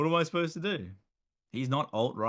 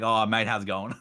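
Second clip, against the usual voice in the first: slightly louder, blokey Australian greeting; broad Aussie accent; 5 dB louder than usual. Retold or imitated. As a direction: switch into a tradie Australian accent. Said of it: "the hardware store ... full on swapped".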